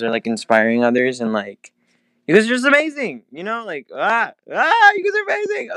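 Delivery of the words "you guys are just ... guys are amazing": high, gushing burst of praise; very energetic. Real, not acted. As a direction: squeal it out with joy. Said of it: "Very energetic".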